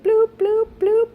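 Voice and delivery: high playful "bloop, bloop"; bloop noises. Retold or imitated. Telling viewers about getting sick, mock bubbling sound. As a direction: pop out silly high bloops. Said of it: "That's a big indicator".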